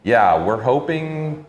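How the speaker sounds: ordinary delivery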